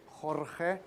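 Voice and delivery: "Jorge" with the J said as a throaty h, "HOR-heh"; soft, slow "Jorge", the J sounding like h; Spanish pronunciation. Shown as a demonstration. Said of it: "with a J, but with H".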